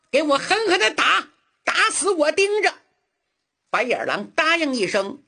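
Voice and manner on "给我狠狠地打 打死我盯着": a rising, higher barked command to beat him; the master loses his temper and orders the blows; angry order. Acted. (恼怒地高声下令)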